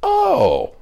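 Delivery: a sudden penny-drop "Oh"; surprised "Oh". Retold or imitated. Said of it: "and was like".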